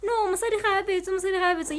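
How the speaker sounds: high, insistent brushing-off; dismissive protest. Retold or imitated. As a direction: wave it away, no no no. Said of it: "And we're like".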